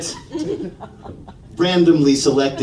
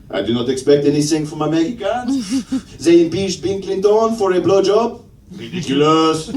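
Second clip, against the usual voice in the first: mock-indignant French accent. Comedian voices French reaction to Trump winning, French accent. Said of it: "The first ones that came to mind were the French".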